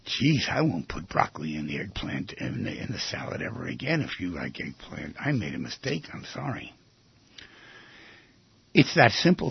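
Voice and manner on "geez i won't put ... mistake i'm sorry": soft, much lower, heartfelt sorry; sincere apology. Shown as a demonstration. Apologizing to an angry partner, softer and totally sincere. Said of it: "with all the sincerity in the world".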